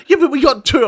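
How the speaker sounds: high, loud imitation of mum protesting; mock-mum justification; defensive mum. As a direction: pipe up in mum's defence. Acts out mum defending the purchase.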